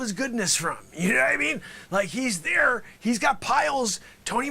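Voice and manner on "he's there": ordinary talk, nothing marked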